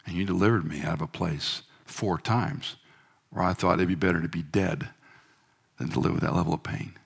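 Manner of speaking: soft intimate prayer, sinking to a whisper; hushed prayer. Retold or imitated. Preacher voicing his own quiet prayer of thanks to God. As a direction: pray it quietly and close.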